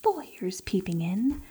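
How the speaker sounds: hushed, secretive whisper to himself; scheming whisper. Acted. Schemer whispers alone over stolen files. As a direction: plot in a whisper.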